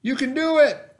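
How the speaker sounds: high, loud, cheering him on; a grandpa urging the boy to keep pedaling; encouraging cheer. Acted. Grandpa calls out to Gus on the bike, high excited cheer. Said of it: "Grandpa said".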